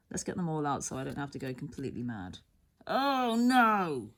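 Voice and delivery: normal talking voice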